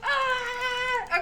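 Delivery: long, very high whimper; high despairing whine. Real, not acted. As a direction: let out a drawn-out squealed "ah". Despair over lost progress.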